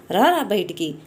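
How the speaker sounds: plain reading voice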